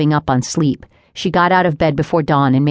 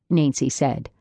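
plain reading voice

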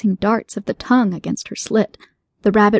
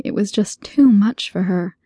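plain reading voice

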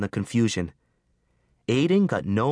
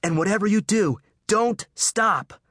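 higher, urgent harsh order; 11 semitones higher, 3 dB louder than usual. Acted. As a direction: bark the instruction over the fire. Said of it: "he said harshly".

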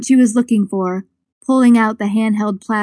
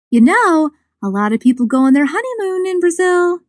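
lifted higher, hinting hopefully; hopeful suggestion; 8 semitones higher than usual. Acted. Goldie hinting at a honeymoon to Campbell, hopeful and higher. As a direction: drop the hint with bright higher hope. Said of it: "she suggested hopefully".

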